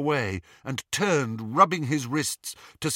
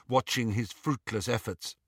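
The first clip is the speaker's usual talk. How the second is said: ordinary delivery, nothing marked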